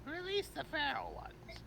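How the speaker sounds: high slow menacing order; drawn-out villain command; sinister villain. Pretend. Villain gives cruel order.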